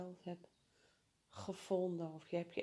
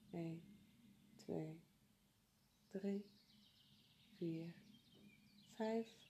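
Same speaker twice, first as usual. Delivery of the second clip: soft counting; 7 dB quieter, slower than usual. Acted. Counting breaths softly.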